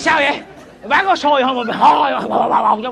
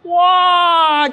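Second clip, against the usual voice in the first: long, high, louder wow; amazed wow; 7 semitones higher, 4 dB louder than usual. Acted. Seller amazed by friend's selling trick, drawn-out wonder. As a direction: draw it out in wonder.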